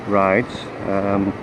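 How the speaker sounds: normal talking voice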